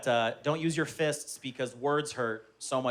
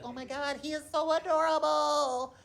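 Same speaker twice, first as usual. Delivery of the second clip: put-on high, drawn-out cooing over a baby; exaggerated, lying compliment; fake gushing; 10 semitones higher, 4 dB quieter than usual. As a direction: gush insincerely in a high put-on voice. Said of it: "Some people will lie".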